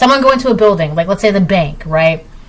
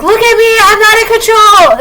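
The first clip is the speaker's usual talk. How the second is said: much higher, louder, mocking cry for attention; mocking whiny plea; 12 semitones higher, 5 dB louder than usual. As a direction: parody someone begging to be seen. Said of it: "lash out or, like, project".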